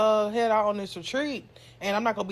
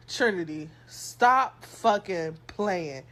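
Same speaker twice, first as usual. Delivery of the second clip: very slow, weighted warning; stern warning; 3 semitones lower, much slower than usual. Retold or imitated. Mom telling her kid to stop playing, stern. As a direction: stretch out each word like a mother's last warning.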